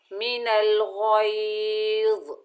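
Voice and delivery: मिनल गैज़ with heavy ghain and za, slow; thick ghain and za. Shown as a demonstration. Teacher reciting मिनल गैज़ heavily. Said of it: "मोटे अदा होंगे".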